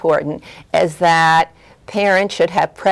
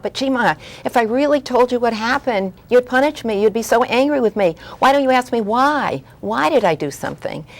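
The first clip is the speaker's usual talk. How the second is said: put-on pleading child; 7 semitones higher than usual.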